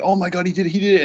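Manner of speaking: high-pitched retold cheer, rushing with excitement; excited cheering. Friend retelling how he cheered for the player, excited cheering. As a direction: imitate yourself cheering on a friend. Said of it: "I was cheering tirelessly for you".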